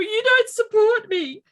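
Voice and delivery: high, loud, slow wail of self-pity; mock crying. Wife wailing at her husband in the car, high and loud. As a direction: wail it like a teary tantrum. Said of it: "And then I'm crying, going".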